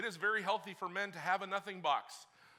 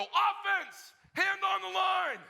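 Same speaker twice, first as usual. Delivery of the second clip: drawn-out bark, much higher and louder; coach yelling; 9 dB louder, much slower than usual. Retold or imitated. Coach calling the offense to the line, yelling. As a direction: call the team to the line like a coach. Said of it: "they go".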